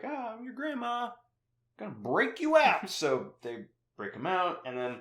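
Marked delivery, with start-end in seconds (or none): 0.0-2.9 mock grandma voice. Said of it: "He's like".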